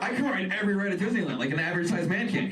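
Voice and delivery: normal talking voice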